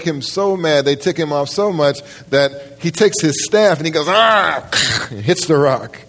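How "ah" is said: a sharp angry yell as he strikes; angry shout. Retold or imitated. Moses yelling in anger as he hits the rock. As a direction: burst out in frustration.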